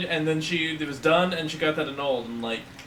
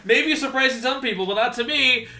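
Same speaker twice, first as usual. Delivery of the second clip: award announcer; 8 semitones higher, 5 dB louder than usual. Acted. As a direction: announce the winner with fanfare.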